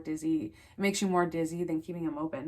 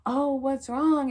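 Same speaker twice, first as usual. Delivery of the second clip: higher, louder, drawn-out mock concern; joking, teasing; 7 semitones higher, 6 dB louder, much slower than usual. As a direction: imitate a partner teasing, slow and high. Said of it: "he's trying to joke".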